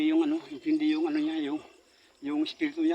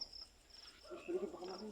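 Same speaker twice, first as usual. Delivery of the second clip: hushed whisper; 19 dB quieter, slower than usual. Real, not acted. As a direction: whisper it, barely voiced. Whispering while ghost hunting.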